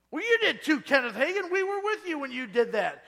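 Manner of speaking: loud, high, laughing insistence; insistent friends. Retold or imitated. Old friends loudly insisting to Hagin he did it, laughing. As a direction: shout him down, laughing.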